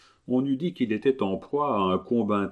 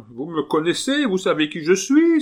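cri aigu de terreur, plus fort; s'écrier, épouvanté; 10 semitones higher, 6 dB louder than usual. A narrator's reading. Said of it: "avec l'expression de la plus profonde terreur". (le malade s'écrie devant le moine, terrifié)

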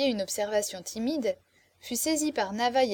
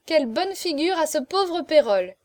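high, loud, mirthful outcry; amused exclamation; 6 semitones higher, 5 dB louder than usual. A narrator's reading. Girl mocks the poor steward at the keyhole, amused exclamation. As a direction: exclaim it with mocking delight. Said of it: "s'écria-t-elle en éclatant de rire".

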